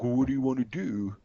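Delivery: mocking, dithering voice; mock indecision. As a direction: play an unsure rep deferring to the customer. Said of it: "they don't want you to be like".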